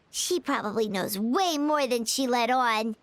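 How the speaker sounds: ordinary talk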